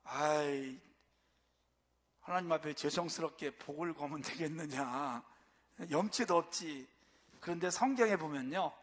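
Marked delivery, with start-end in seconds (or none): 0.0-6.9 low, soft, self-effacing mock objection; imitated reluctant objector. Preacher imitates believers embarrassed to ask God for blessing, sheepish. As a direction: voice a sheepish objector, lowered and subdued. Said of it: "어떤 분들은".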